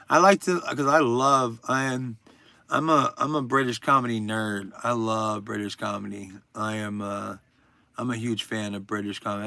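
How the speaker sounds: ordinary delivery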